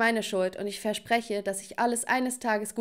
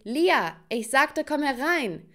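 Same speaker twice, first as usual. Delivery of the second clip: raised, higher calling voice; shouted order; 5 semitones higher, 4 dB louder, slower than usual. A narrator's reading. Mother calls her daughter inside, shouting. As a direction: call out as an impatient mother. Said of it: "die schrie".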